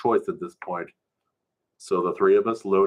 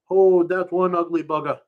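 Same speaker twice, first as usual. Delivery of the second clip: high, loud pidgin jeer; mocking pidgin; 10 semitones higher, 6 dB louder than usual. Narrator mocks Polly's canoe, loud pidgin jeer. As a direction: laugh at how ugly it is.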